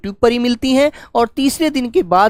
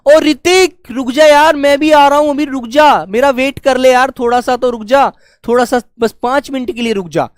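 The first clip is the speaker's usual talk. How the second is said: calling out; 3 semitones higher, 3 dB louder than usual. Retold or imitated. Teacher acting a kid calling to a friend ahead. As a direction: call after a friend who is walking off. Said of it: "दूर से पुकारते हैं".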